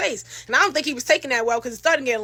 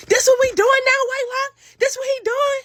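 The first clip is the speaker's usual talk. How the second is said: much higher, loud indignant Whitelock with a rising end; outraged confrontation; 12 semitones higher, 4 dB louder than usual. Retold or imitated. Jealous woman behind her confronts him, outraged. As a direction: call him out, shrill and furious.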